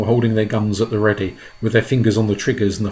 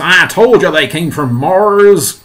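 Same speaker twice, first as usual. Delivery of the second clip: loud, slow, higher I-told-you-so; calling out; 7 semitones higher, 9 dB louder, slower than usual. Acted. Police chief proved right to fire chief, loud call. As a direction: call it out, drawing the words. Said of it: "called the chief of police".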